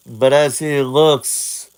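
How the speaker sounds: ordinary delivery, nothing marked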